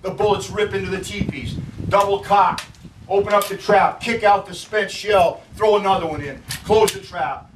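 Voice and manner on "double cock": usual speaking voice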